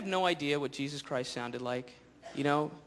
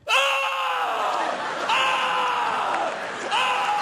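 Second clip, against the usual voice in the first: very high, loud straining yells; hammed-up gym screams; mock weightlifter grunts; 6 dB louder, slower than usual. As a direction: bellow each rep like a showoff lifter. Gym guy grunting lifting.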